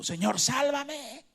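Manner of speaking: high, slow desperate cry; a drowning man calling for help; crying out to the Lord. Acted. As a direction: plead for rescue, loud and high. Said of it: "dio voces diciendo".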